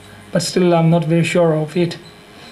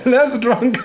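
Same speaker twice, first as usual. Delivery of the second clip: laughing; 5 semitones higher than usual. Real, not acted. Laughing at his own joke.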